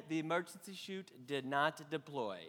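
ordinary delivery

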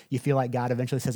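ordinary delivery

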